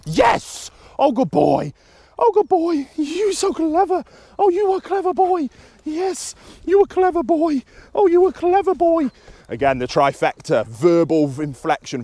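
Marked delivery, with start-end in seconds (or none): 0.1-9.1 very high, gushing pet-talk; excited dog praise. Real, not acted. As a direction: reward the dog with a delighted high voice. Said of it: "vocal inflection, praise, physical praise".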